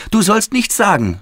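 put-on high, urgent boy's outcry; high-pitched protesting shout; child's yell. A narrator's reading. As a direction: cry out like an upset little boy. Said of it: "schrie Willi".

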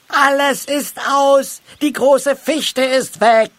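high, loud, drawn-out call; calling out from afar. A narrator's reading. Halbschuh to Moosbart from up the tree, calling out. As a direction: shout it down from a treetop, slowly. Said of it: "Da hörte er Halbschuh vom Baum rufen".

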